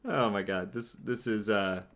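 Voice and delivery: normal talking voice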